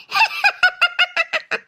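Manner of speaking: high ghostly cackle; mocking ghost cackle; evil cackle. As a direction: cackle at her useless screams. Ghost mocks the screams.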